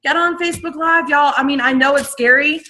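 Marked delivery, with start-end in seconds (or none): 0.0-1.3 high, pumped-up push to get on Facebook Live; rally them like a hype coach; urgent cheer: get on Facebook Live; excited urging. Real, not acted. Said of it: "that is my number one tip".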